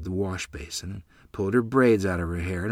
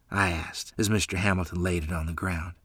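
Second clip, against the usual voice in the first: ordinary delivery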